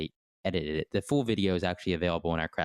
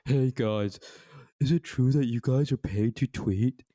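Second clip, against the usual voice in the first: hammed-up corny question, pitched up; 5 semitones higher, slower than usual. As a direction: put on a corny interviewer act. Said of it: "in a really corny voice".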